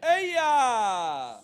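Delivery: loud, high call to the crowd; rallying shout. Real, not acted. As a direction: shout it to rouse everyone. Leader's call to congregation.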